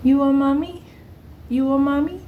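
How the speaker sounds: put-on high, sweet toddler asking; little girl voice. Toddler asking the pregnant worker about her belly, high and sweet. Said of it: "She's like".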